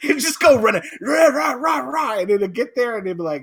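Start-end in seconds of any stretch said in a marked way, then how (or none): 1.0-2.2 dog barking. Retold or imitated. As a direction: bark like a charging dog. Imitating dog charging.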